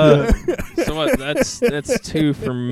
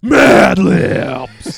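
big, loud, high segment call; hyped show-segment announcement; announcer call; 11 semitones higher, 14 dB louder than usual. Acted. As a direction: belt out the name like a show host.

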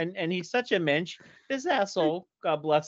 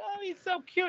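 much higher, cooing gush; gushing buddy; 4 dB quieter than usual. Retold or imitated. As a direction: coo like a smitten friend. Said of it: "my buddy's like".

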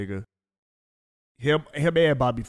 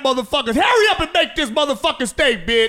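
high, loud mock chef yell; mock kitchen screaming; 13 semitones higher, 5 dB louder than usual. Imitating a chef screaming at cooks, loud and high. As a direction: yell like a furious head chef. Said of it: "screaming at motherfuckers".

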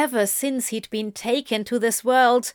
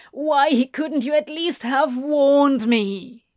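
building from upset to a higher, louder anguished cry; a demon losing his composure over hurting his friend; anguished outburst; 4 semitones higher, 3 dB louder than usual. A narrator's reading. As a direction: let the hurt crack open as it rises.

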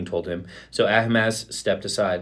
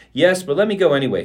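higher-pitched, insistent plea; begging; 6 semitones higher, 4 dB louder than usual. A narrator's reading. Ahimaaz pleads with Joab to let him go, begging. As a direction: beg to be allowed, pitch lifted. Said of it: "he begged".

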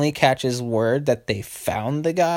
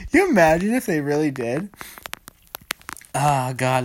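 ordinary talk, nothing marked

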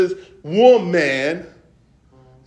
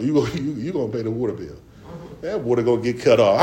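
put-on folksy old sitcom dad; 8 semitones lower, 4 dB quieter, much faster than usual. Parents laughing, voiced parent.

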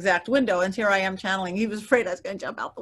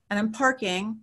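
usual speaking voice

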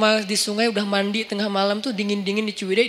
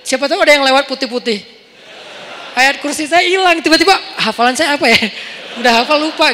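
loud, high retold panic; mock-frightened inner panic; comically spooked; 4 semitones higher, 6 dB louder than usual. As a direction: play up the jitters, raised voice. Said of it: "Aduh ngeri banget gini".

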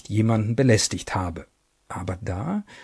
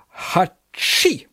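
sneeze; 7 semitones higher than usual. Acted. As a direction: act out a big sneeze. Character sneezes.

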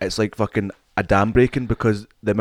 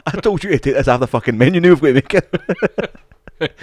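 higher, slowing to a hammed-up groan; mock chef; 6 semitones higher, 5 dB louder than usual. Chef scolding colleague about menu dish, mock exasperated. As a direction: play a fed-up chef.

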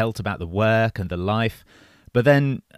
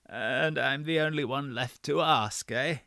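put-on frail breathless old person; 5 semitones higher, 7 dB quieter than usual. A narrator's reading. Old doctor voiced in story.

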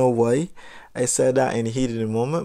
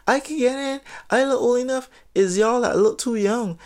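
put-on much higher, smug girly voice; mocking the girl; 11 semitones higher than usual. Imitating the girl bragging she can get into the club, high and smug. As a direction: squeak out her boast like a bratty kid. Voicing the girl's boast.

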